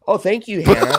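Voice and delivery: high, laughing thank-you; delighted thanks. Real, not acted. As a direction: gush with delight. Flattered by fan superchat.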